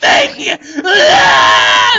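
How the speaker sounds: loud, high maniacal cackle; evil cackle. Acted. Monster head cackling at the kids, evil cackle. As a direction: cackle like a stop-motion monster. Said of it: "Like that".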